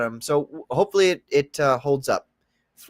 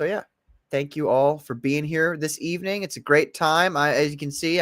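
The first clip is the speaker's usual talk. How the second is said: ordinary delivery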